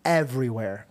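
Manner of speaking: normal talking voice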